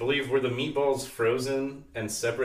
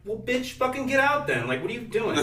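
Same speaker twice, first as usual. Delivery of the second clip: much higher, mock-indignant retort; exasperated inner retort; 10 semitones higher than usual. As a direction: fire back high-pitched in mock outrage. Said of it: "I'm like".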